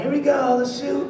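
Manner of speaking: high, upbeat set call; cheery high on-set call; director calling action. Retold or imitated.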